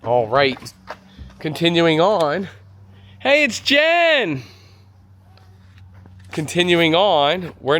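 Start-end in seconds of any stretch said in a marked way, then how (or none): 3.2-4.4 high, drawn-out excited greeting; excited call-out. Real, not acted. Reviewer spots colleague Jen mid-tour, excited high call-out. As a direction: sing out a delighted hello, stretched and high.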